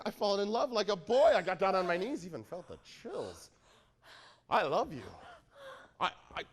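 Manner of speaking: ordinary talk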